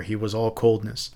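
ordinary delivery

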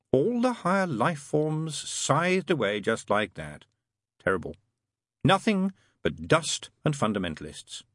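slow, mildly mournful angel; gloomy. A narrator's reading. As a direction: dwell on it with a touch of gloom. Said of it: "said Aziraphale gloomily".

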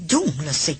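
low mutter through barely open lips. A narrator's reading. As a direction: grumble it under your breath, worried. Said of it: "พูดลอดริมฝีปากออกมา". (พึมพำลอดริมฝีปาก เสียงต่ำ)

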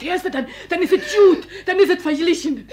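loud, laughing plea; loud pleading. Acted. Frau John loudly begging the maid to hit her.

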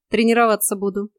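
ordinary delivery

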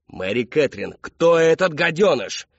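loud, slow, climbing demand; furious shout. A narrator's reading. Father demanding the culprit, slow furious shout. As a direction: build to a drawn-out angry yell.

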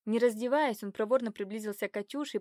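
ordinary delivery

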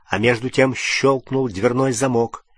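plain reading voice

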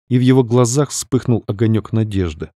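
usual speaking voice

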